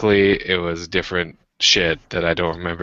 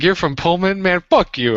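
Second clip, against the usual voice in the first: high-pitched mock outrage at a rival; mock indignation; 10 semitones higher, 3 dB louder than usual. Retelling first meeting with Carl, mock indignant. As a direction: take high-pitched, joking offence.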